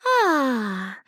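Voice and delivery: ordinary talk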